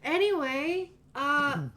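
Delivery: a mellow surfer-bro hello; friendly stoner. Acted. GM voices stoner proprietor.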